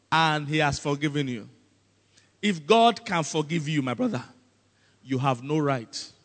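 normal talking voice

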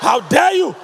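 mock outrage. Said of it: "you dare not touch your meat".

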